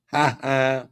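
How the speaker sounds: ordinary talk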